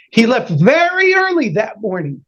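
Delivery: ordinary delivery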